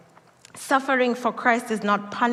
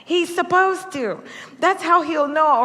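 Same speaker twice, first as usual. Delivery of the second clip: higher, louder anxious cry; a nervous mother's higher-pitched worry; worried parent; 7 semitones higher, 4 dB louder than usual. Retold or imitated. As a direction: fret that the baby will fall. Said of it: "I'm like".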